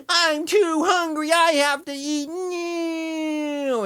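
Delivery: put-on high, slow whining like a starving child; whiny mock voice. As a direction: whimper it like a fake crybaby. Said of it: "and you're like".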